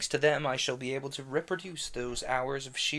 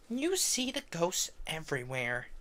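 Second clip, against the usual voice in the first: put-on dismissive girl; 4 semitones higher than usual. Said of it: "who had more or less kept her head".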